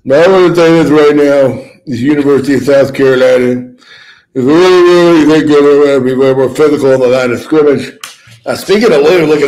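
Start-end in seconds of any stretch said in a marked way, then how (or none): 0.1-7.9 louder coach voice, lisping lightly; rally the team like an old football coach; Lou Holtz impression. Doing Lou Holtz for the radio crowd, louder. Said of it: "Lou Holtz impression".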